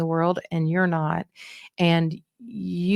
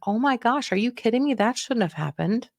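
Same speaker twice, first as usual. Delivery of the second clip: higher, animated outrage on their behalf; validating indignation; 4 semitones higher than usual. Shown as a demonstration. Coach demonstrates siding with an upset loved one, sympathetically indignant. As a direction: get on their team with shocked sympathy. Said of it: "with the right tone and body language".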